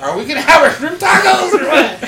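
high, loud needling; instigating tease. Retold or imitated. Narrator needling the upset friends, loud high tease. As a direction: poke at them to stir it up. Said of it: "I was like".

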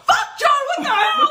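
high, loud panicked laughing yell. Retold or imitated. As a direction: shriek at him in panic, laughing. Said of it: "I'm like".

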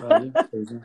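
said through a laugh; chuckling as he speaks. Real, not acted. Laughs along with S1.

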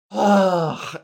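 loud disgusted groan; real exasperated ugh; frustrated ugh. Real, not acted. Frustrated with voice training.

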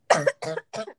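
low rough cough; coughing fit. Real, not acted. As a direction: cough hard into the mic. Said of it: "sorry, guys. I'm coughing a lot".

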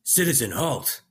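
stern, loud order; commanding guard shout. Acted. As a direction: bark a halt command. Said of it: "I heard a deep voice".

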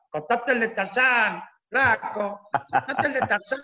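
put-on high, slow, drawn-out drunken shouting; drunk heckler yelling. Drunk neighbour heckling the comedian for the Tarzan joke, slow high yell. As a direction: bellow it like a tipsy neighbour in slippers. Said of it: "y gritaba".

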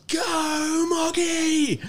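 high-pitched yell cheering on a winger; retold stadium scream. Father cheering at the footy, a sudden high scream. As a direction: let it rip, calling a player from the stands. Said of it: "I just screamed out at the top of my lungs".

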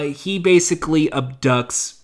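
usual speaking voice

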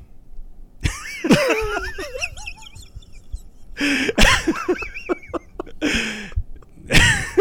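real high-pitched cackling; long laughing fit. Real, not acted. Joker after landing the punchline, long high laughing fit. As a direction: crack up helplessly at your own punchline.

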